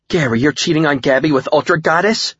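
high-pitched, shocked question; horrified. A narrator's reading. Cindy confronts her friend about cheating, horrified. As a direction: blurt it out aghast.

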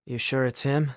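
soft, hushed question; half whispered. A narrator's reading. Nick privately checks with Mary, half whispering. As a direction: ask it under your breath. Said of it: "Nick half whispered".

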